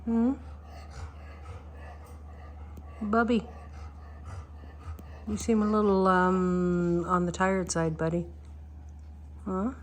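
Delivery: quiet, gentle talk to a dog; soft pet talk. Real, not acted. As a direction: softly check on a sleepy pet. Talking softly to tired dog.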